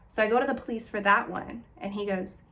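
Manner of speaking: ordinary talk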